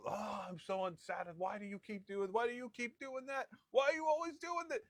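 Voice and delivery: mock blow-up. Spouse blowing up in a fight, mock angry rant climbing in pitch. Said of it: "they get in a fight ... And they blow up".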